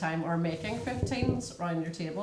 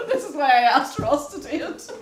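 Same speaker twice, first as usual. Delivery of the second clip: laughing; 7 semitones higher, 10 dB louder than usual. Real, not acted. Laughing at husband's measuring line.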